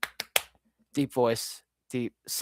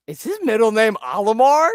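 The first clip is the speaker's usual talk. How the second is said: incredulous; 10 semitones higher, 8 dB louder than usual. Real, not acted. Incredulous question about name.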